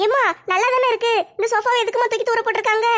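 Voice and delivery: put-on young daughter voice.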